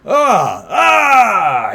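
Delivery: loud high yells of waking up; startled cries. Acted. Knocked-out man comes to, loud cries. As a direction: cry out as you come to. Game master voices man coming to.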